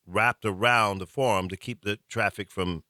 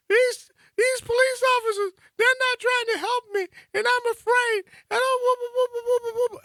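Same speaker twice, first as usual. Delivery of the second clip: high falsetto sobbing wail, hammed-up; mock a panicked woman bawling down the phone; imitating hysterical crying; 3 dB louder than usual. Scared passenger sobbing to her driver on the phone, high mock wail. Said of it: "and she's crying".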